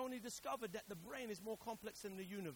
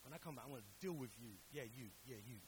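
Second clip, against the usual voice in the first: mock threat; 7 semitones lower, 9 dB quieter, faster than usual. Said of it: "we'd be like".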